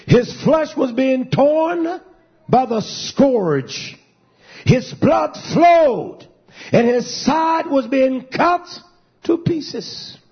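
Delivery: slow, high, stretched phrases; dramatic pulpit storytelling with heavy pauses; impassioned preaching. Acted. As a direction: draw out each blow of the story. Preaching the scourging.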